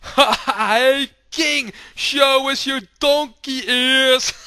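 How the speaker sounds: high, loud, slow taunting jeer; mocking crowd. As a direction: call out to mock the king. Crowd mocks the king.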